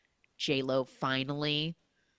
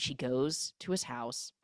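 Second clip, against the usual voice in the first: ordinary talk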